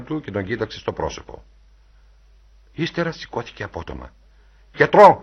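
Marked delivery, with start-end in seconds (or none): 4.7-5.2 loud, high cry for help; sudden raised-voice summons; urgent shout. A narrator's reading. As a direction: call out for a doctor in alarm. Calling urgently for a doctor.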